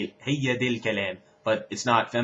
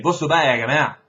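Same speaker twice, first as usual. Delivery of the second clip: calling out; 4 semitones higher, 7 dB louder than usual. Shown as a demonstration. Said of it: "trying to get their attention".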